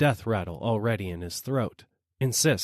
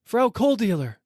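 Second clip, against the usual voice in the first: much higher, loud call; crying out; 12 semitones higher, 5 dB louder than usual. A narrator's reading. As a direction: hail her from above. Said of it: "I cry".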